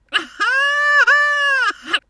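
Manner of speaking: high shrieked laugh; shrieking laugh. Real, not acted.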